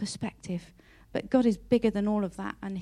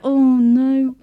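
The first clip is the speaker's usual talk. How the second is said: dismayed cry; 4 semitones higher, 13 dB louder than usual. Retold or imitated. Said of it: "you're like".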